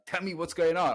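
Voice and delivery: a father losing patience with his son; impatient, insistent ask; frustrated demand. Retold or imitated. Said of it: "Now I'm getting frustrated".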